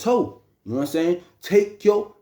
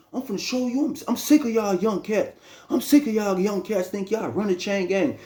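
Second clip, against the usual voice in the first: furious outburst; 7 semitones higher than usual. Retold or imitated. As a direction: blow up at the young cat. Uncle explodes at the accusation.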